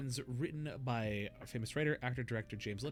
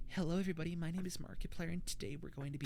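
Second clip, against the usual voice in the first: put-on e-boy voice; 5 semitones higher, faster than usual. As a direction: coo the intro like an e-boy. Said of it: "e-boy Markiplier".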